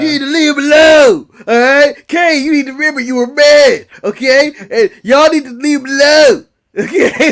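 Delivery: loud, emphatic wrestler promo impression; X-Pac. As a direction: lay down the law, punching the line endings. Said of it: "That's my impersonation of X-Pac".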